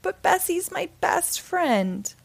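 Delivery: Jack's protest. Acted. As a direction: plead like a put-out farm boy.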